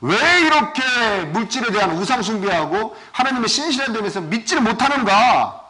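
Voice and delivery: normal talking voice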